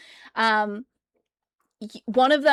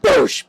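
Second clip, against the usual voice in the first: loud higher "boosh" like a thump; mock banging sound; 11 semitones higher, 9 dB louder than usual. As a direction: thump it out like a fist on glass. Zombies banging on window.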